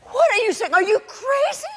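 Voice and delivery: high-pitched, shocked outburst; appalled disbelief. Acted. Mother shocked by daughter's affair confession, high and incredulous. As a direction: shoot the pitch right up in horror.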